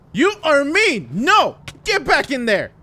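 high, loud indignant yell; indignant scolding. Acted. Friend orders Bobby back into the water, indignant. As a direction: yell it in bursts, pushing the culprit away.

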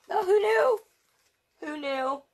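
high, loud happy gasp; delighted surprise. Real, not acted. Reader delighted the princesses fall in love. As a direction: squeal with surprise.

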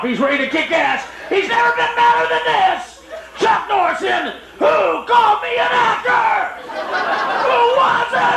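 hammed-up action trailer announcer; mock trailer voice. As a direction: build up like a movie trailer. Movie trailer build-up.

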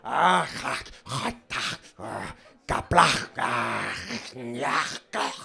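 low, harsh guttural barks; mock Klingon speech in choked consonants; Klingon, consonants only. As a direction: spit out throaty Klingon syllables. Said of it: "que solo hablan con consonantes".

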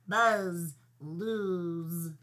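buzzing fly voice with long z's; Fly Guy. A narrator's reading. The fly tells Buzz who lost, buzzing. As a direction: drone each word out on its z. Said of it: "And Fly Guy said".

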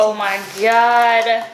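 loud, drawn-out slow delight; amazed. Real, not acted. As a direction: savour it with real amazement. First bite of the tacos.

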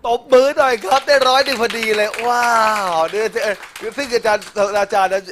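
high and loud, celebrating the score; excited announcement. Real, not acted. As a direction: cheer the result to the crowd. Teacher celebrates score 101. (ประกาศผลอย่างตื่นเต้น เสียงดัง)

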